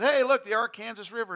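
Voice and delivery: loud, eager "Arkansas" pointing out the river; excited call out. Retold or imitated. Pastor shouting to his elders in the car, excited. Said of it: "And I said".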